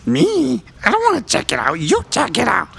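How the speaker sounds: high, reluctant goblin voice; a nervous goblin passing the buck, high; scared goblin. Acted. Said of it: "you hear".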